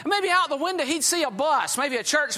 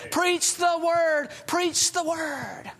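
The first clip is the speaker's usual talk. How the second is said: shouted refrain; 2 semitones higher, much slower than usual. Real, not acted. Preacher repeats refrain, rising.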